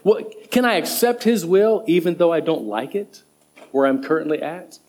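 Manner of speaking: normal talking voice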